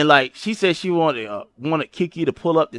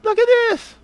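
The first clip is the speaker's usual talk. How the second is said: much higher, hammed-up shrill yell; mock high shout. Retelling what he told Kiki, in a high mock shout. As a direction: shriek it like you just caught her. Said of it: "I say, I say".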